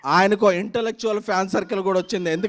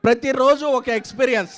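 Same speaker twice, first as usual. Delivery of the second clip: normal talking voice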